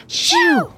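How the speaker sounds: high sharp sneeze sound. Retold or imitated. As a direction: burst out a sneeze. Sneezing with mouth full.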